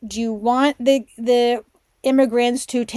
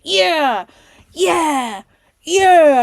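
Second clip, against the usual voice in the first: loud, high whoops of victory; reliving an exultant cheer; triumphant celebration; 7 semitones higher, 8 dB louder, much slower than usual. Retold or imitated. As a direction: whoop each one, building to the biggest. Retold celebration on video call.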